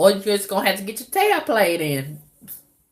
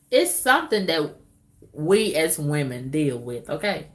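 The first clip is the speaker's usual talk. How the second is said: ordinary delivery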